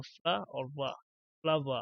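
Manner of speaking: फ्ला और वा, then फ्लावर, broken apart slowly; split into syllables. Shown as a demonstration. Teacher breaking the word apart for the class. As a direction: spell out फ्ला and वा, then join them into फ्लावर. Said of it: "देखो मैं क्या बोल रहा हूँ".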